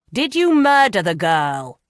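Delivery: loud demanding shout; loud, furious shout. Acted. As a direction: bark the question at the prisoner. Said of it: "Pomfroy shouted".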